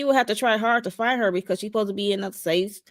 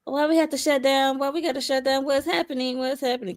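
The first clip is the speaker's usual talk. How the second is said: higher, lightly voiced innocent questioning; imitating innocent act; 6 semitones higher than usual. Woman imitates a character who seemed innocent in the first episode, higher mock-innocent questions. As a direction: play at innocent confusion, pitched up. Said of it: "she did come off that".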